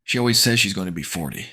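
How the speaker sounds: plain reading voice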